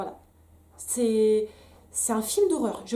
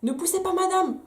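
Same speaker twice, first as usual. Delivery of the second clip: much higher, mocking midwife command; mock shrill order; 8 semitones higher, 4 dB louder than usual. Mother mocking the midwife's order to stop pushing. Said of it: "Bref, donc".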